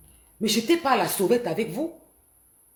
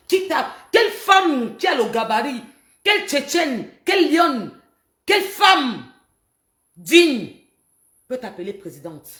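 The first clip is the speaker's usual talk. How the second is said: shouting, higher and louder; furious shout; 4 semitones higher, 5 dB louder than usual. Real, not acted. Tearing into Marie Sandra's title claim, furious shouting. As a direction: hurl each question with fury.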